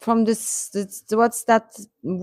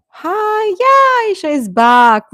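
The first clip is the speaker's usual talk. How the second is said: excited greeting; 12 semitones higher, 7 dB louder, slower than usual. Real, not acted. Greeting a friend who rejoined.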